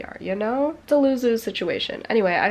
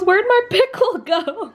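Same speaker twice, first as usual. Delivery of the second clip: imitating mom; 12 semitones higher, 10 dB louder than usual. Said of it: "She goes".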